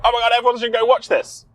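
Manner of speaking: high, quick gushing rave; mock enthusiasm. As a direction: imitate an over-keen recommendation. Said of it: "go home and be like".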